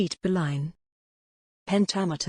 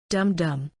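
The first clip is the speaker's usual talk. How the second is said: "dum dum" with both syllables stressed; spondee stress. Shown as a demonstration. Teacher demonstrating a spondee's beat, "dum dum" equally stressed. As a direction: give each "dum" in "dum dum" equal weight. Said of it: "stressed, stressed, the beat is".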